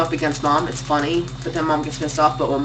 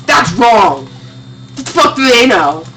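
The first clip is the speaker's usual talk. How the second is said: louder mock outrage; indignant dad; 4 semitones higher, 5 dB louder than usual. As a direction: scoff like an offended dad. Dad dismissing movie ratings.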